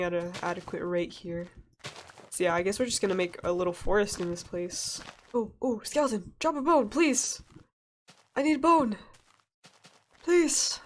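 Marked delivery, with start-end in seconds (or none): 5.3-10.8 high, desperate begging at a mob; half-joking, high-pitched plea to a game monster; pleading call. Real, not acted. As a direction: plead with the skeleton, voice climbing. Begging a skeleton for bones.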